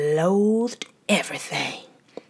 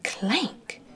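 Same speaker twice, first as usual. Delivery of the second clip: soft whispered clank; hushed clank; 4 semitones higher, 6 dB quieter than usual. A narrator's reading. Narrator voicing a mysterious night sound, hushed. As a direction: breathe it like a spooky noise.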